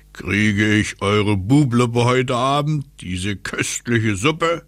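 slow, relishing wind-character voice; slow bargaining voice of a wind; North Wind asking. Acted. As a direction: draw the question out greedily. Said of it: "fragte der Nordwind".

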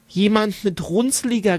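ordinary delivery

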